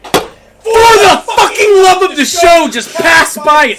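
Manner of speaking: high, loud, slow pleading yell; exasperated shout. Acted. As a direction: yell it in mock despair. Begging co-host to move on.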